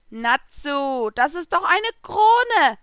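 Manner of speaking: squeaky cartoon cat, exclaiming; Happy the cat. A narrator's reading. The little blue cat explaining to Natsu, high and excited.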